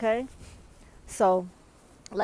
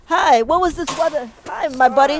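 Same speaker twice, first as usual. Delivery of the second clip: loud greeting; 5 semitones higher, 9 dB louder than usual. Real, not acted. Greeting a neighbor outdoors.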